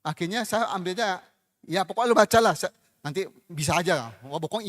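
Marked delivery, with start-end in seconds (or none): 1.7-4.1 exasperated loud retort. Retold or imitated. Romo telling the pleading caller to just read it, loud and fed up. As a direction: burst out loudly, fed up with the pleading.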